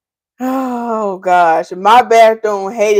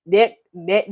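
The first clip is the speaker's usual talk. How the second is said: ordinary talk, nothing marked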